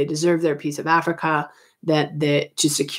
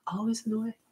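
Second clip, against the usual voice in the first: a quick soft annoyed grumble under the breath; muttered aside; 5 semitones higher, 14 dB quieter, faster than usual. Real, not acted. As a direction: mutter it to yourself, half-annoyed. Annoyed aside about Napoleon on map.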